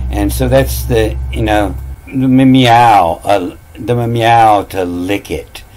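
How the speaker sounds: normal talking voice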